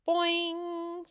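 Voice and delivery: high, loud playful yell; one ringing, high 'boing'; muffled yell. A narrator's reading. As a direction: twang it out like a spring. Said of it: "yelled a muffled".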